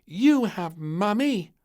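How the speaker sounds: put-on high, loud, slow child's reply; little girl retort. Princess answering the king, high and slow. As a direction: throw it back like a sulky child. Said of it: "said little Princess".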